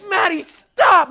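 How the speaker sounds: loud high slow shout, half laughing; shouting at dog. Retold or imitated. Owner yelling at dog chasing a rabbit, loud shout. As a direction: yell the command to stop her.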